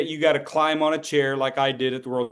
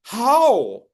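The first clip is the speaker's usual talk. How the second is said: baffled student; 8 semitones higher, 6 dB louder than usual. Retold or imitated. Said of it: "They go".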